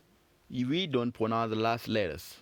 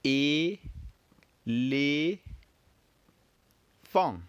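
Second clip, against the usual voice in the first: "e, le, fang" drawn out with pauses, higher; teacher repeating "e, le, fang" one piece at a time; each syllable of "e, le, fang" held separately; 3 semitones higher, much slower than usual. Shown as a demonstration.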